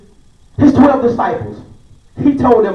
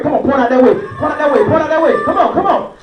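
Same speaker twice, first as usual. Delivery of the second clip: ordinary talk, nothing marked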